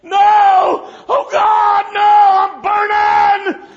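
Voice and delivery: high, loud panicked screams, dragging out burning; dying man in the hospital screaming in terror; terrified screaming. Retold or imitated. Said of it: "and he's going".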